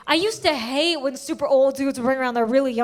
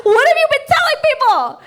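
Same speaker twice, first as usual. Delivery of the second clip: shocked; 12 semitones higher, 8 dB louder than usual. Retold or imitated. Shocked at mom.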